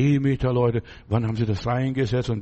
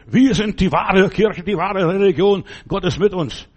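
slow, much louder and higher proud proclamation; mock sect zealot; 4 semitones higher, 5 dB louder than usual. Preacher mocking a sect's claim to truth, slow pompous loud boast. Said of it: "Dann geraten sie in eine Sekte".

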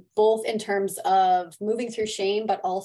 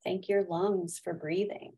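guided meditation; 5 dB quieter than usual. Acted. Leads a gratitude meditation.

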